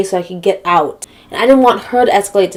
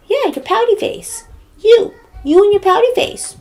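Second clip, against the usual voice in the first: mock taunting neighbor; 12 semitones higher than usual. Neighbor taunting her about her face, high mocking voice. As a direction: jeer it in a high put-on voice.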